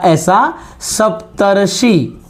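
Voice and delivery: सप्तर्षि spoken slowly, the र clear; सप्तर्षि, drawn out; slow careful pronunciation. Shown as a demonstration. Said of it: "हमने शब्द लिखा".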